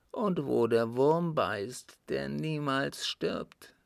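put-on halting, laboured confession of a guilty old man; agonised appeal to his son, weighted with guilt; anguished dying father. Sick father confessing to his son Arthur, anguished.

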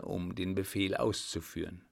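usual speaking voice

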